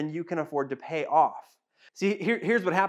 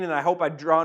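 normal talking voice

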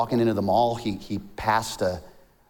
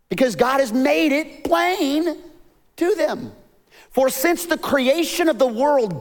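impassioned preaching; 10 semitones higher than usual. Real, not acted. Preacher reads scripture to the congregation, impassioned. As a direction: preach it with rising fervour, pitched well up.

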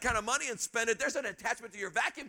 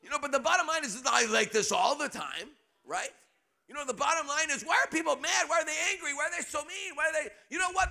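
normal talking voice

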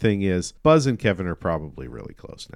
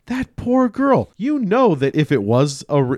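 pitying; 9 semitones higher, 5 dB louder than usual. Retold or imitated. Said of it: "I was like".

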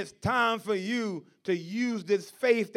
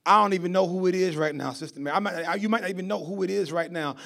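normal talking voice

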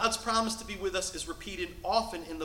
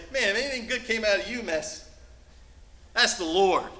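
scoffing worldly voice; 3 semitones higher, 6 dB louder than usual. Retold or imitated. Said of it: "when the world has to say".

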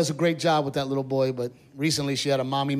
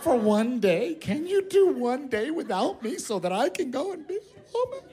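mock crying wife; 7 semitones higher than usual. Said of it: "my wife crying, she says".

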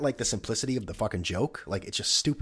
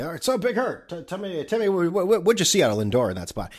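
high, eager TV host voice set well above his own; Burkhardt impression; 10 semitones higher, 6 dB louder than usual. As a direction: play the fussy host cueing his analyst. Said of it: "Burkhardt has to be".

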